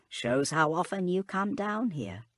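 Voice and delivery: a hint of a wistful old servant; high old woman voice. A narrator's reading. Hook teasing, character voice.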